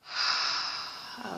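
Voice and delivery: a long, breathy sigh of release; big sigh. Real, not acted. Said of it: "So another big sigh".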